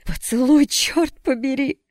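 high, loud desperate cry; desperate shout. A narrator's reading. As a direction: scream it in despair. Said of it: "отчаянно выкрикнула Джесс".

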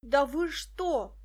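put-on boy protesting at the police; shouting. A narrator's reading. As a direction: yell in outrage. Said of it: "закричал Петька".

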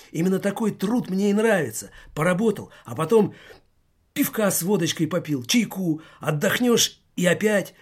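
put-on high, loud agitated boy. A narrator's reading. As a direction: defend himself heatedly. Agitated schoolboy argues.

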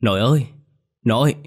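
high, alarmed call to grandma; acted frantic grandson's shout; panicked screaming. A narrator's reading. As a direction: cry out in sudden panic. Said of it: "hốt hoảng gào thét".

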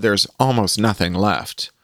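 usual speaking voice

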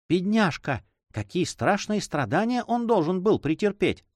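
high-pitched, compassionate exclamation; pitying. A narrator's reading. Pitying the tortured monk. (с состраданием)

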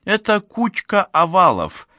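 high-pitched, mildly displeased dismissal; хмурое возражение. A narrator's reading. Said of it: "нахмурившись, ответила Буря". (недовольно, высоким голосом)

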